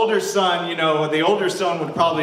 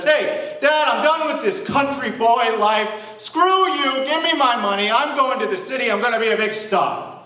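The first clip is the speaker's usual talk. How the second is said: high, loud, cocky son telling Dad off; defiant younger son; 9 semitones higher, 4 dB louder than usual. Acted. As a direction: throw it at your father, brash and raised. Younger son demands money from father.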